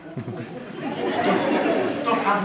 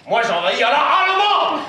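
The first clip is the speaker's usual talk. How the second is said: high, loud boast; bold declaration; 9 semitones higher, 9 dB louder than usual. Acted. Con man resolves to pass as a German, rousing boast. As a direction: proclaim it like a rousing battle cry.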